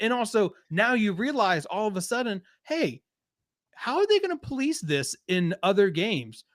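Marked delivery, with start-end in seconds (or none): none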